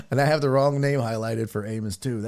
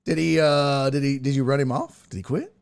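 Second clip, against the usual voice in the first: ordinary delivery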